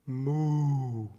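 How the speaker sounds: long, drawn-out cow lowing; cow moo. Acted. Cow breaks up the monk's chant, long mooing. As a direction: moo like a cow, held out smoothly. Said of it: "until a noise breaks up his chant".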